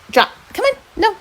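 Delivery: usual speaking voice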